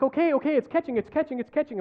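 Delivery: high, loud frantic giving-in; screaming surrender. Retold or imitated. Patient gives in to doctor hurting his shoulder, screaming. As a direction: blurt it over and over, yelling in mock agony. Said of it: "And I'm screaming out".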